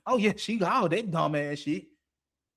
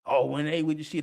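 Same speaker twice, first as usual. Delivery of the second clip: normal talking voice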